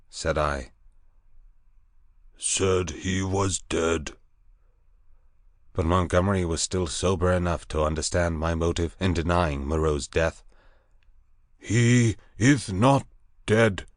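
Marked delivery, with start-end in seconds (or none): 2.4-4.2 very slow beast voice; slow creature voice. Acted. Grey thing speaks. 11.6-13.9 slow, weighty denial; slowly. Acted. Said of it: "he said slowly".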